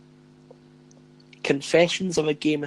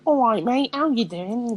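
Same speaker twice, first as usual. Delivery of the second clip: cheery YouTuber greeting; 7 semitones higher, 3 dB louder than usual. Retold or imitated. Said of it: "I'm just used to hearing".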